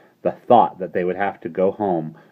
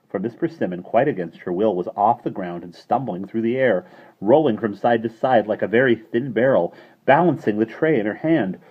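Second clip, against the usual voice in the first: ordinary delivery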